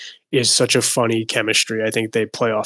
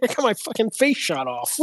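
mock outrage; 11 semitones higher, 4 dB quieter than usual. Acted movie quote.

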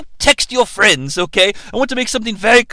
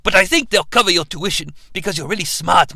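ordinary talk

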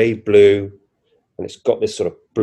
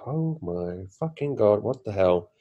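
retold stunned awe; 7 dB quieter than usual. Recalling a hand turned robotic, quietly stunned.

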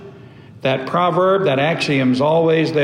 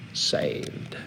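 drops to a breathy whisper on the last word; dramatic whisper; 13 semitones lower, 11 dB quieter than usual. Real, not acted. Preacher to congregation, hushed whisper for weight. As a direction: whisper it to let the point land. Preacher's point on being saved.

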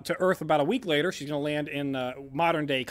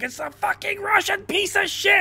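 much higher, louder put-on Russian cursing; Russian accent; 4 dB louder than usual. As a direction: shout it like an angry Russian mechanic. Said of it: "there's a Russian that's up there and he's like".

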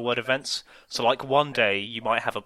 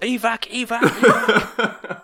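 loud, high mock battlefield alarm; mock panic; 8 semitones higher, 8 dB louder than usual. Playing a soldier calling for evac over a hairball, mock panic. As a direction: yell a hammed-up evacuation call.

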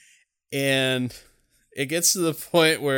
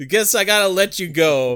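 higher, louder mock concession; grudging Otis; 7 semitones higher, 8 dB louder than usual. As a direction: act out a villain reluctantly giving in. Acting out Otis granting release.

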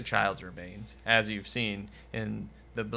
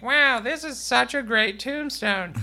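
put-on high, slow, gushing child voice; a child thrilled with the gift; delighted kid; 12 semitones higher, 4 dB louder than usual. Child unwrapping a birthday tombstone, high, slow gush. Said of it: "let it unwrap and be like".

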